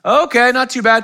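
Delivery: high, loud voice as dad; dad's check-in. Retold or imitated. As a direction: play the boss father checking work.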